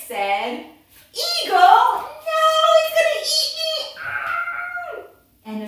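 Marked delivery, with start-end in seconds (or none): 1.1-5.0 loud, high panicked shriek; hammed-up scream for your life; fast mock-terror yelling; terrified snake.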